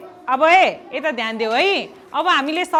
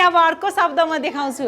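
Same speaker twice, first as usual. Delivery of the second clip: usual speaking voice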